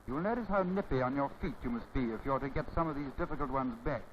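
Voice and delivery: ordinary delivery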